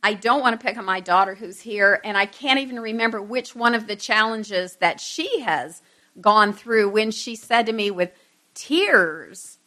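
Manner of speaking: usual speaking voice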